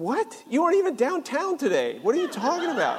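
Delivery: high-pitched incredulous dad; baffled disbelief. Retold or imitated. Dad doubts son's sudden confession, high baffled disbelief. Dad's baffled reply, retold.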